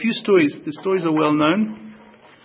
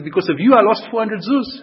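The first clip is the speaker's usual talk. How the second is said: louder and higher, indignant complaint; cheeky outburst; 5 semitones higher, 6 dB louder than usual. Retold or imitated. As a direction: blame him brazenly.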